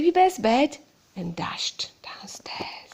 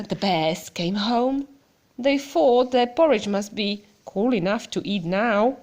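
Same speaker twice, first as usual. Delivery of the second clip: ordinary delivery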